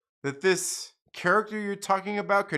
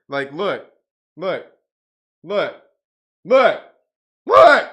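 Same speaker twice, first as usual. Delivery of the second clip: building shout; 11 dB louder, slower than usual. Real, not acted. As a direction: escalate each look until shouting. Building up to Superman punchline.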